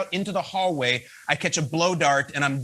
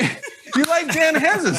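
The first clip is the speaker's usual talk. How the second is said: high, loud, laughing "you like Dan Hanzus?"; disbelieving the fan picked his co-host, voice much higher; surprised; 9 semitones higher, 4 dB louder than usual. Retold or imitated. Host retells his surprised reply.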